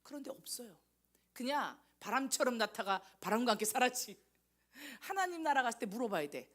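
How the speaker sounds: normal talking voice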